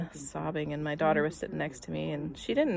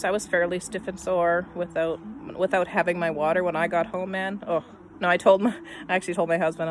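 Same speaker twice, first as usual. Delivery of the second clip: usual speaking voice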